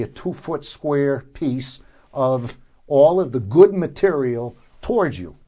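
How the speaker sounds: ordinary talk, nothing marked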